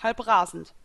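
ordinary delivery, nothing marked

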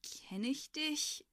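slow, drawn-out sceptical question. A narrator's reading. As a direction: ask it warily, stretching it upward. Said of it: "fragte ich skeptisch".

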